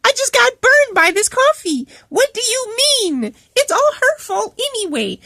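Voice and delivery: high, indignant imitation of coworker; attitude. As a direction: mimic a huffy, affronted complainer. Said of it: "he gets an attitude".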